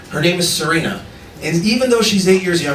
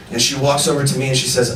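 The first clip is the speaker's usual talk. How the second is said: usual speaking voice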